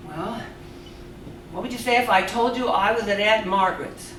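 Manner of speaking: normal talking voice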